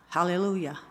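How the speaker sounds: a limp, unenthused Hallelujah; half-hearted hallelujah. Shown as a demonstration. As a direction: mumble Hallelujah without any joy. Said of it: "Don't work, does it?".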